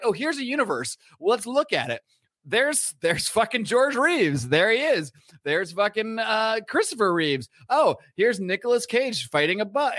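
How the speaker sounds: high, sing-out cameo spotting; mock wonder. Critic mocking the film's parade of cameos, high mock wonder. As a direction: point out each sight in mock amazement.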